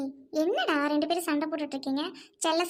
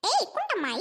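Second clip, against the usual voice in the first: high jeering call-out; taunting call; 7 semitones higher than usual. Acted. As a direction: call her out with a sneer. Calling out to rival woman.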